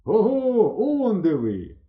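high, drawn-out call across water; sudden hail. A narrator's reading. Boys hailing friends from the river, calling out. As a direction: call out as if spotting lost friends. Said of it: "Несподівано з плеса залунало".

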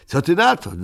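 boastful excited ask; proud question. Acted. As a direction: show off your treasure. Muminek showing off his find.

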